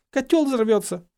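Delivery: high, clear repeat; unmuffled warning. A narrator's reading. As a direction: say it clearly with the cloth removed. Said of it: "отняла импровизированный фильтр от лица".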